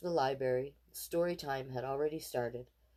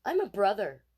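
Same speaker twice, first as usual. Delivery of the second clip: put-on insistent boy; 5 semitones higher, 8 dB louder than usual. A narrator's reading. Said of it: "Casey said".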